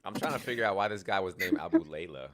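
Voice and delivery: ordinary delivery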